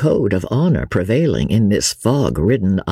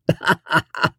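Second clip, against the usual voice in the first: short high chuckle; brief self-pleased laugh; 8 semitones higher than usual. A narrator's reading. Sir Percy pleased at making his wife laugh, chuckling. Delighted at his own wit.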